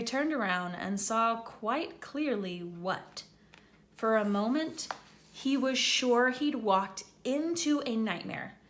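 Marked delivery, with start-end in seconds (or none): none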